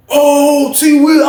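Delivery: high, loud delighted recognition; thrilled reunion cry; excited greeting. Retold or imitated. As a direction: hail an old friend, voice way up. Old friend greets him back.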